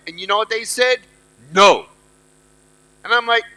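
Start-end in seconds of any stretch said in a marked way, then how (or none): 1.5-1.9 low, loud, blunt mock refusal; strict parent. Parents refuse their child's toy, strict. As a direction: imitate a parent shutting it down. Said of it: "that was strict".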